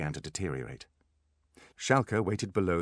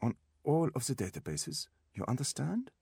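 put-on higher, German-accented doctor; raised-pitch German doctor voice; Schalke's German accent; 9 semitones higher than usual. A narrator's reading. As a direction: instruct an assistant in a German accent. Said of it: "his accent muted German".

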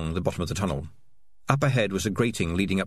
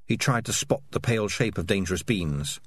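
ordinary delivery, nothing marked

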